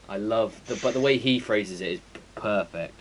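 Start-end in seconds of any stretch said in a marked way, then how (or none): none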